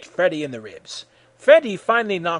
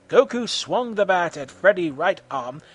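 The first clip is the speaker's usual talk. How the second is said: ordinary delivery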